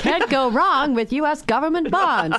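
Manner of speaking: put-on loud, high old-time announcer; 1940s newsreel voice. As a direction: boom it like a wartime newsreel narrator. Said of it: "That's my, like, nineteen forties newsreel voice".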